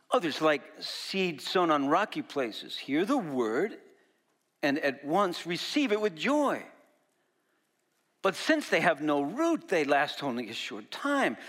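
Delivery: preacher reading scripture with rising intensity. Acted. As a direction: proclaim the verses to the congregation. Reads scripture passage aloud.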